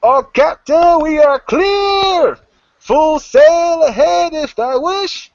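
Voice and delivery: very high, long-drawn lookout shout; loud, stretched seafaring call; One Eye. Acted. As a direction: holler the news to the captain. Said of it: "you hear One Eye go".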